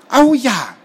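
loud, high, delighted cry; eager shout. A narrator's reading. Boy invited to tell the tale, eager shout. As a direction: jump at the offer loudly. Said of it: "rief Robert".

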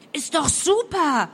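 high, loud, drawn-out yell; loud, soaring shout down the phone; bellowed cheer. A narrator's reading. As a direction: yell it out in delight. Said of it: "brüllte Helly".

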